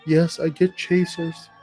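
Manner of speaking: normal talking voice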